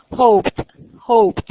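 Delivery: "Hoped" in one loud syllable ending "pt"; final -ed as T. Shown as a demonstration. Teacher drills phonetic ending for students, "Hoped" loud with final T. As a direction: punch out "Hoped", closing on T. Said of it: "Termina en T".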